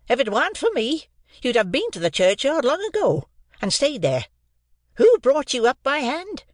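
put-on a harsh sister berating a boy; high, loud furious scolding. A narrator's reading. Mrs Joe scolds.

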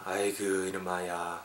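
low, slow, very soft grumble; muttered exasperation. Acted. Acted scolder sighing at a messy friend, muttering low. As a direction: grumble it under your breath.

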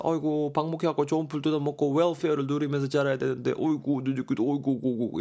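hammed-up sighing lament over the cows; mock lament. Teacher voicing a vegetarian grieving for cows, mock lament. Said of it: "이렇게 말하고 있죠".

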